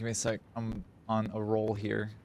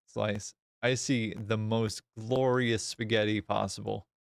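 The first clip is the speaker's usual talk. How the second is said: ordinary talk, nothing marked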